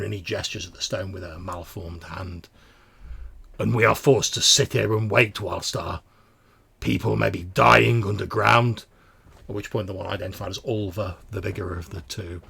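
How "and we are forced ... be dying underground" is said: loud, halting, troubled protest; doubting smaller mutant. Acted. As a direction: question your leader haltingly but with force. Voices the smaller mutant's complaint.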